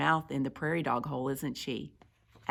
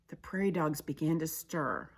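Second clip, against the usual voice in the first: usual speaking voice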